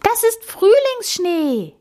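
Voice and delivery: very high, drawn-out exclamation; high-pitched, slow call. A narrator's reading. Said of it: "rief Amalie jetzt".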